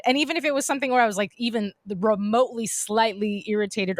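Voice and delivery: ordinary talk, nothing marked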